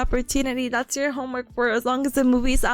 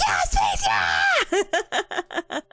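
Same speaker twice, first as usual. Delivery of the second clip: much higher cheering yell, laughing at the end; mock cheer; 6 semitones higher, much slower than usual. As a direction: cheer for space like a fan in the stands. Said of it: "It's like".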